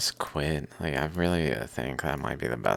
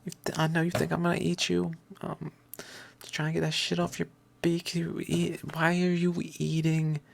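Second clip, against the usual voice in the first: higher, fast, exasperated scolding; exasperated high voice to bird; 14 semitones higher than usual. Retold or imitated. Talking to the bird stuck on fence, exasperated. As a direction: scold the dumb bird, pitched up. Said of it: "I'm like".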